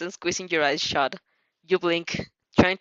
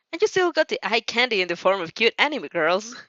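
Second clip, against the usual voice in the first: plain reading voice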